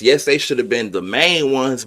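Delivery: ordinary talk, nothing marked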